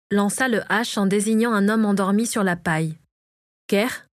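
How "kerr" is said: "Kerr" as a short rising question; questioning call. A narrator's reading. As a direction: say "Kerr" with a questioning lift at the end. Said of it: "répéta-t-il".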